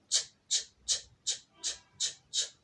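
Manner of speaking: whispered choo-choo sh sh; sh sh like kids playing train; train sound. Shown as a demonstration. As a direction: puff like a toy train. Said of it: "بيلعبوا لعبة القطر".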